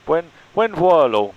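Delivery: drawn-out loud Spanish farewell; slow Spanish accent. Acted. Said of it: "need to work on my, uh, Spanish accent".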